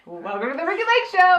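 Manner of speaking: talk show host intro. Retold or imitated. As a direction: announce it like a daytime TV host. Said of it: "it would be amazing".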